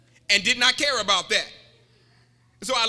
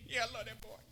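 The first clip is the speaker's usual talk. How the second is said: grandma's sly voice; 4 semitones lower, 18 dB quieter, faster than usual. Retold or imitated. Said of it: "that grandma said".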